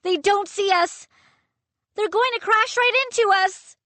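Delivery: high, loud terrified cry; a girl shrieking in terror; panicked scream. Acted. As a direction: yell in horror at the ship. Narrator panicking at the crash.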